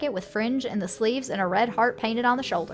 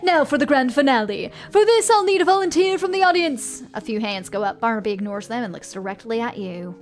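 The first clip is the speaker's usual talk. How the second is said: louder, higher theatrical ringmaster; showman; 4 semitones higher, 5 dB louder than usual. Acted. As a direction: coax a volunteer like a sly showman. Showman picks volunteer.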